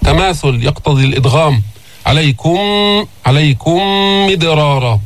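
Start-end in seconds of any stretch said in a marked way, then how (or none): 2.1-5.0 عليكم مدرارا with the two م fused into one hum; nasal held م linking عليكم to مدرارا; merged meem. Shown as a demonstration. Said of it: "ندخل الميم في الميم".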